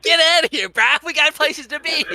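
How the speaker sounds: mock brush-off. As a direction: play the buddy shooing him off, loud and high. Imitating friend brushing him off.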